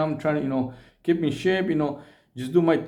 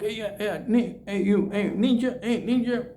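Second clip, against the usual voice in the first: imitating a street greeting; 7 semitones higher than usual. Imitating gang members calling out.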